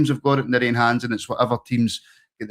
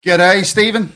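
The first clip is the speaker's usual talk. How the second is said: higher, louder mock Aussie greeting; a hammed-up attempt at an Australian accent; 8 semitones higher, 7 dB louder, slower than usual. Shown as a demonstration. Host greets a caller in Australia, putting on an Australian accent. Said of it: "that's my best Australian accent".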